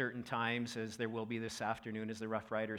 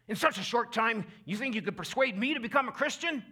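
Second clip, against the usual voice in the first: higher, louder scoffing challenge; a king scoffing in anger at Paul; angry king; 10 semitones higher, 8 dB louder than usual. Retold or imitated. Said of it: "and he's so angry".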